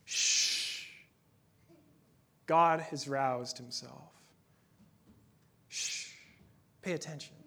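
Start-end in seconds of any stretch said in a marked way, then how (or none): 0.0-0.8 whispered Hebrew hush; shush. Shown as a demonstration. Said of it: "The word for silence in the Hebrew is actually a sound". 5.7-6.1 soft hush; quiet shh; quiet shush. Shown as a demonstration. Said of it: "is actually a sound".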